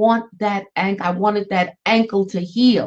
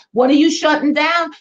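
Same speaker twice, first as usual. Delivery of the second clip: ordinary talk, nothing marked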